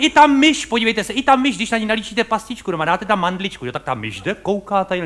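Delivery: ordinary talk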